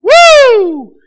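loud high cowboy whoop; excited whoop. Real, not acted. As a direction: let out a big joyful holler. Cowboy greeting cheer.

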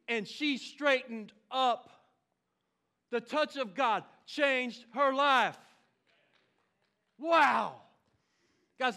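loud, rising preacher's fervor; full-force preaching. Acted. Pastor fires up his congregation, loud and fervent. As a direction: preach it out, stirring the church.

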